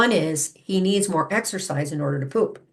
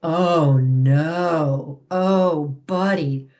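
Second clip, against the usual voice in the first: louder, a demonstrated upset groan; the owner demonstrating disappointment over chewed shoes, louder; 3 semitones lower, 5 dB louder, much slower than usual. Shown as a demonstration. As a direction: show the dog you're upset, louder. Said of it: "as I began our chat".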